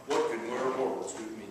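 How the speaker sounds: deep, hushed movie-hero voice; Thor. Acted. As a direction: drop low like a Marvel superhero. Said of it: "I can see Thor saying something like that".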